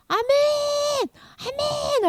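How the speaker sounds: high, drawn-out amens; worshipping amen. Acted. People worshipping before they kneel, high fervent amens. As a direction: send each amen up in worship.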